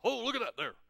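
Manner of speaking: chuckling presidential impression; President Obama. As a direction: marvel with a chuckle as the dignitary. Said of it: "President Obama picks up".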